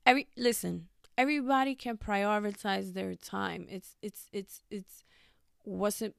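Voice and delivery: normal talking voice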